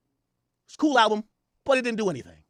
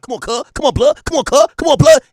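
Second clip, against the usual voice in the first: West Coast gang talk, building from normal to much louder and higher; mock the West Coast guys, getting louder each line; mocking West Coast slang; 8 semitones higher, 12 dB louder than usual.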